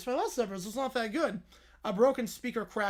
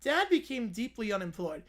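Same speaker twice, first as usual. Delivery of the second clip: ordinary talk, nothing marked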